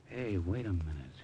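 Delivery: slow hushed whisper. Acted. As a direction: whisper it back, slow and secretive. Hushed reply about spies.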